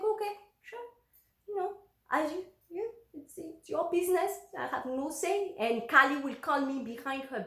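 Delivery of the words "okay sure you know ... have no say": resigned mock agreement.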